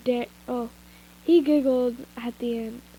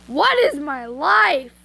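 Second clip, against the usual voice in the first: exasperated; 3 semitones higher, 7 dB louder, slower than usual. Real, not acted. Exasperated at the naming confusion.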